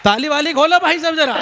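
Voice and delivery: high, loud hype call to the crowd; rally the audience to clap; loud call for applause. Real, not acted. (ज़ोर से तालियों की पुकार)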